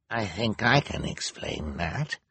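slow, measured character voice; familiar old Hal, grave. A narrator's reading. Hal entering the operating theatre, slow and grave. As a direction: draw the words out gravely as an accuser. Villagers' accuser Hal speaks.

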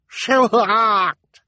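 put-on high, loud baby wail; small child's tearful outburst. A narrator's reading. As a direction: cry it out like an upset infant. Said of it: "Sunny said tearfully".

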